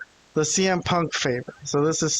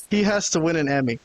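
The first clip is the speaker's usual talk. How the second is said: normal talking voice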